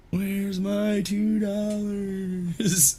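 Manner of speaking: put-on high, slow drawn-out kid voice; paperboy voice. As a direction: stretch it out like a whiny paper boy. Repeats paperboy line.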